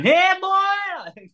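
high yelled greeting from a stranger; mock shout. Stranger yelling at the band in his face, mock shout. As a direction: shout it way up high like a mad local.

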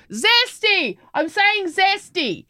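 high, slow exaggerated reply; mocking herself. Mother mimicking her own reply to the kids, high and slow. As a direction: drag it out, high and teasing. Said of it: "And I'll go".

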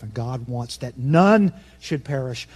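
ordinary talk, nothing marked

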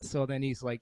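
usual speaking voice

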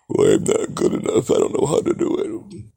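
breathy, whining imitation of a timid listener; mock whiny excuse. Q mocking a fan's excuse in a breathy, whiny put-on voice. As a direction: mimic a self-doubting fan, whining.

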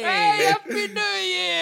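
excited cheer. Real, not acted. New Year cheer.

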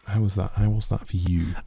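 ordinary talk

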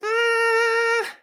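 high squealing party horn squeak; party horn noise. Retold or imitated. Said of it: "Did, did that sound like a little party?".

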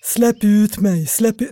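slow, drawn-out attempt at a dull voice; put-on dull voice, stretched vowels; muffled deep-set voice. Shown as a demonstration. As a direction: recalibrate into a hollow, heavy call. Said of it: "jag får kalibrera igen".

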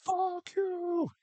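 high, strained mock scream of pain; mock angry yell. Coach fallen on his stump, high hammed-up furious yelling. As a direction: yell it up high like an injured man. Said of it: "He just goes".

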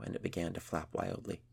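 ordinary delivery

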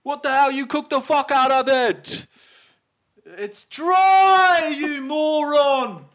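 screaming way up high and loud, half laughing; furious chef shout. Retold or imitated. Chef berating a cook over overcooked meat, furious shouting.

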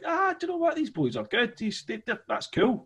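admiring the band over a pint; impressed bar punter. Retold or imitated.

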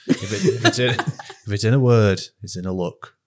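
broad northern English accent, laughing through it. Shown as a demonstration. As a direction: tagline in a playful northern accent. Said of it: "can you do that in a northern accent".

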